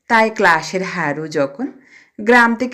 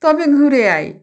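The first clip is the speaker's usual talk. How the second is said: a higher, thin, defeated squeak; feeble squeak; 6 semitones higher than usual. A narrator's reading. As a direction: give in weakly in a high little voice. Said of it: "চি চি করে বললেন".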